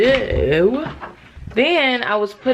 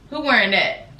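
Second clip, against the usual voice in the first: laughing; 6 semitones higher than usual. Real, not acted. Laughing at tiny dress.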